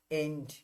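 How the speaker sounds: "এন্ড" as one syllable, ending in -nt; contracted pronunciation. Shown as a demonstration. Teacher showing the contraction, "এন্ড" pronounced as one word. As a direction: say "এন্ড" as a single short form of am not. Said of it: "তখন এরকম হবে".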